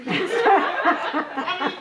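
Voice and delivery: high, loud burst of laughter. Real, not acted. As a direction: break into laughter at the memory. Laughs recalling John Lewis embarrassing.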